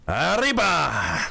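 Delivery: long drawn-out "Arriba!" in Spanish accent, swooping up high; mock Spanish cry. Shown as a demonstration. Streamer showing off some Spanish for viewers, mock Spanish cry. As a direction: stretch out a hammy Spanish call. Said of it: "There's some Spanish".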